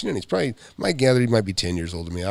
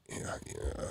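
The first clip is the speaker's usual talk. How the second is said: whispered; 14 dB quieter than usual. Real, not acted. Sighing, frustrated after looking.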